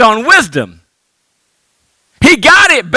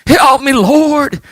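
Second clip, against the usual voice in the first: mock begging; 3 semitones higher, much slower than usual. Preacher mocking old Baptist church begging, slow whiny plea. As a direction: ham up an old church member begging the Lord.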